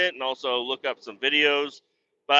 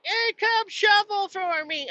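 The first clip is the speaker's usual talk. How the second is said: much higher, slow mock whiny voice; mocking family's request; slower than usual. Mimicking his family asking him to shovel, mock whiny high. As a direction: parody them calling him away to shovel. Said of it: "and they're like".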